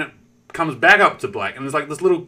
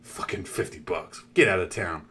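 softer and lower, scoffing; muttered scorn; 8 semitones lower, 7 dB quieter than usual. Retold or imitated. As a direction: grumble it under breath. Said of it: "I'm like".

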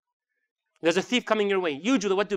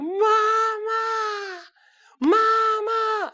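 very high, loud wail for mom; imitated child yelling, crying; 4 dB louder, much slower than usual. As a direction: wail it like a small child in tears.

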